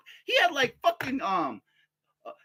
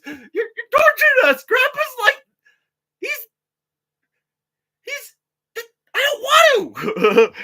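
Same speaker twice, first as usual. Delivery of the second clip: put-on whiny kid protest; 13 semitones higher, 7 dB louder than usual. Imagined son whining about grandpa.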